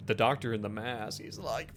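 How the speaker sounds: normal talking voice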